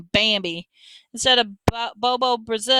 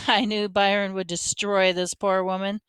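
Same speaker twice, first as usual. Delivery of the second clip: ordinary delivery, nothing marked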